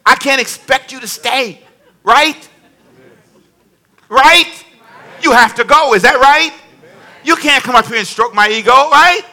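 loud teasing questions to the crowd; hey, right? with a grin; teasing call-out. Real, not acted. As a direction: needle them playfully, rising high. Teasing the congregation, calling out.

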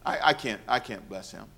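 hushed heavenly regret; soft sorrowful God. Retold or imitated. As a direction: grieve it quietly, almost to yourself. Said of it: "God has a meeting in heaven saying".

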